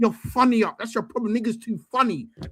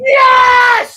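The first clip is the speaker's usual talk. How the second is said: high, loud cheer of joy; loud celebratory shout; excited yes; 9 semitones higher, 8 dB louder than usual. Real, not acted. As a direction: shout yes at the good news. Celebrating transfer news.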